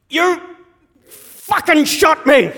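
high, loud, drawn-out cry; acted high-pitched furious shout; outraged yell. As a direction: yell it slowly in shocked outrage. Wounded dad swears at son.

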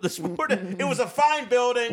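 slow, high and loud, savoring it; grand nostalgic exclamation. Real, not acted. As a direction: proclaim it like a fond memory. Repeats it with a flourish.

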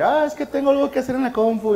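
high-pitched, evasive whine of an excuse; mock excuse. Husband dodging his wife's series, evasive.

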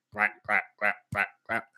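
usual speaking voice

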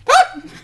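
sudden very high, loud yelp; startled yelp. Real, not acted. Host caught off guard before reading the points, real startled yelp. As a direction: let out a sharp, high shriek of surprise. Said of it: "Scared, scared the poop emoji out of [name]".

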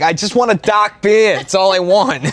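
put-on dad's Boston accent. Dad pleading with baffled bar staff, exasperated Boston accent. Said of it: "He's like".

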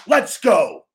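high, loud punch on Let's; promo-ending shout. Shown as a demonstration. Wrestler ending a promo with a loud shout. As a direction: close the promo with conviction. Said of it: "at the end of it".

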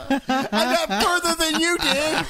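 high laughing voice. Real, not acted. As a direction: laugh the words out. Laughing at himself.

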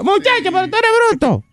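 very high, put-on granny squawk; hammed-up exasperated grandmother; imitated scolding grandmother. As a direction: scold the boy in a comic falsetto. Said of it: "Es que la abuela le diga".

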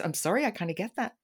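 quick sheepish whisper; whispered aside. Real, not acted. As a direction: confess it under your breath.

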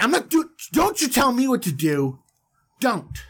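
hammed-up indignation at being told what to do; mock defiance. Defiant Eve voice against being told.

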